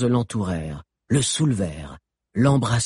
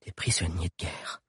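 soft low murmur, much softer; 5 semitones lower, 10 dB quieter than usual. A narrator's reading. Alix murmurs about the war prisoners, hushed. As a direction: murmur it, barely voiced. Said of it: "murmura Alix".

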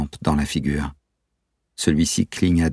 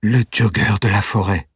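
startled exclamation; 5 semitones higher than usual. Acted. Valeria recognising the masked man, surprised exclamation. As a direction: blurt it out as you recognise him. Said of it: "s'exclama-t-elle".

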